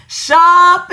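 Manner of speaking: shopping said high and loud; big reveal. Acted. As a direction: land the word shopping hard.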